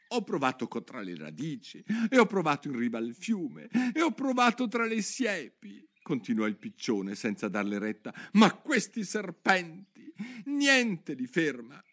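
worried pigeon complaining on and on; fretful pigeon. Acted. (piccione ansioso che si lamenta)

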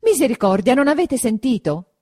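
high, loud frantic reply; pulling free while crying out; loud panicked protest; alarmed cry. A narrator's reading. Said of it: "replicò quella svincolandosi".

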